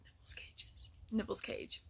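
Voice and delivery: soft whispered aside. Real, not acted. Telling what the bird loved, whispered. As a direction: whisper it under the breath.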